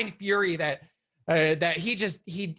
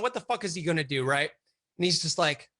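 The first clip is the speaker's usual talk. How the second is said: ordinary talk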